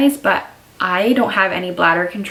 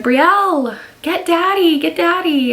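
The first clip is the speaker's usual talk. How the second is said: high, loud call to her daughter; yelling for help; 10 semitones higher, 4 dB louder than usual. Retold or imitated. Mom retelling how she yelled to Brielle, high loud call. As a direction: call out sharply, as if sick on the floor. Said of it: "And I was yelling to Brielle".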